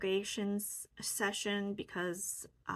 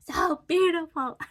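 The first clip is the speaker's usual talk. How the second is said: high, loud, moved laugh; emotional laugh; 10 semitones higher, 10 dB louder than usual. Real, not acted. Sharing her healing with viewers, moved laughing. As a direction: laugh through happy tears. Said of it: "Getting emotional right now".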